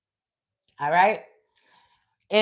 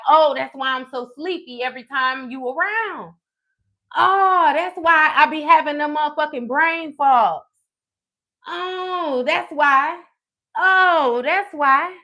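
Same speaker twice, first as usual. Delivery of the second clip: drawn-up, higher-pitched "oh" of sudden understanding; dawning realization; 4 semitones higher than usual. Retold or imitated. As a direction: let each realization click, voice lifting. Acts out repeated dawning realization.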